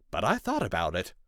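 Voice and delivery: ordinary delivery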